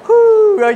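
high, loud whoo of relief; relieved whoo. Retold or imitated. Pastor acts congregant getting wallet back, loud relieved whoo. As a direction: let out a big relieved whoo. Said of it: "now you go".

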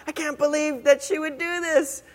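lightly voiced jealous Miriam; indignant Miriam. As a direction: gossip in disbelief like a jealous sister. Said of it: "So Miriam is like".

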